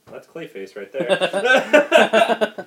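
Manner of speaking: slow, high, loud laughing put-on voice; cackling mock monster; laughing Clayface voice. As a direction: drag it out while cracking up.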